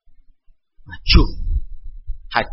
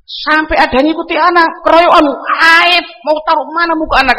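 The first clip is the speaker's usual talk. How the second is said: higher, fast and flustered; mock panicked enemy; 7 semitones higher than usual. Enemy fearing an ambush shames him, flustered mock panic. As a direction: fret that comrades are ganging up. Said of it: "Dia merasa".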